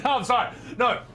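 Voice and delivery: ordinary talk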